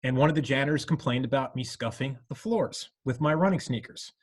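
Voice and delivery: normal talking voice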